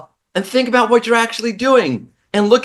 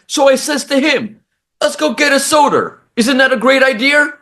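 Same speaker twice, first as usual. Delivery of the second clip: higher-pitched imitation of a New Yorker dad; father's New York accent; 5 semitones higher than usual. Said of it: "My dad says".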